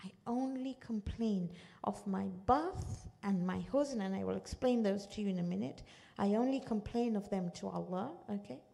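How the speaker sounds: normal talking voice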